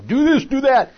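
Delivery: loud, high barked orders; mock commanding. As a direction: imitate a bossy ruler shouting commands. Mimics a bossy ruler giving orders.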